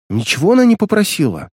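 plain reading voice

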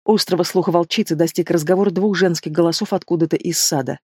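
ordinary delivery, nothing marked

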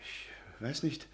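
barely breathed, unvoiced; whispered. Cornered professor answering Magda in a whisper. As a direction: shrink into a whisper.

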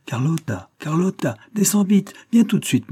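high-pitched call up a dark stairwell. A narrator's reading. Mother calls her daughter down the stairs, high calling out. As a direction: summon your daughter, pitch climbing with each call. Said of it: "cria dans le noir d'un escalier".

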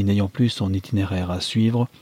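ordinary delivery

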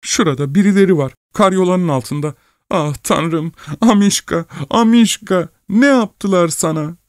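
high-pitched lamenting woman; distressed wife. A narrator's reading. Wife grieving over her dog Amişka, high distress.

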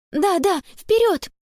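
high, eager hurry-up; urging on. A narrator's reading. Конни hurrying her friends on to search, urgent. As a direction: urge them onward in a lifted voice. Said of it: "подгоняла Конни".

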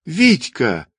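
high exclamation, a mild yell; crying out. A narrator's reading. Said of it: "завопила Ольга". (возглас, почти вопль)

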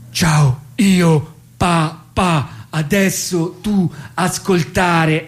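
high, very slow halting words; baby-directed broken talk. Acted. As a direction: speak to the newborn word by word. Father talking to newborn. (lentissimo e acuto, parole spezzate alla neonata)

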